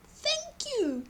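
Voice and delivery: very high, soft squeak; squeaky mice. A narrator's reading. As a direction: squeak a tiny thank you. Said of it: "they squeaked".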